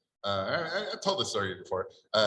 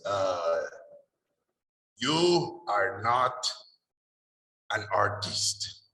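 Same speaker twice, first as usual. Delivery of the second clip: put-on slow, heavy Hungarian accent; 3 semitones lower, much slower than usual. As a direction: pronounce each word with a slow Hungarian accent. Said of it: "his accent was pretty thick".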